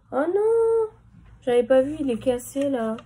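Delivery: sudden high dismayed cry; dismayed surprise. Real, not acted. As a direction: react to finding it broken. Discovers the case is broken.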